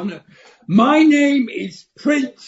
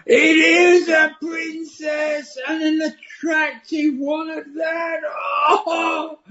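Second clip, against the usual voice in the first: excited; 6 semitones higher than usual. Acted. Frog sees attractive princess.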